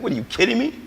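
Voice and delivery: high, disbelieving retort; incredulous. Retold or imitated. Patient told his system is clean, high incredulous protest. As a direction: shoot it back high in disbelief. Retold disbelief at clinic's finding.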